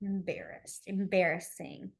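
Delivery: low, soft and slow; hushed demo. Shown as a demonstration. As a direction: say it quietly while signing. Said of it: "brushing up your cheeks".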